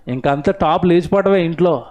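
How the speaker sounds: ordinary delivery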